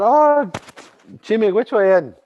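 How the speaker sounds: tiny cave woman calling out her thanks; little Memegwesikwe voice. Acted. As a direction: lift up high and bright into the little one's thanks. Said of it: "And she said".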